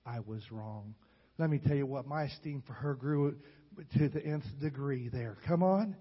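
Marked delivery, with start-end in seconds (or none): 0.0-1.0 much softer, hushed plea of a crying grandmother; a grandmother begging forgiveness through tears, softly; imitating tearful plea. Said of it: "Tears streaming down her cheeks".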